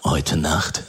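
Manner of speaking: low, hushed conspiratorial question; secret mode. Retold or imitated. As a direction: play along with the secret, low. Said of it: "ich war viel zu tief im Geheimnismodus".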